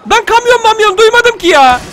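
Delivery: high, loud, slow protest; exasperated yell. Real, not acted. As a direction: wail the objection at full voice. Protesting surprise objective. (isyan edercesine bağırarak)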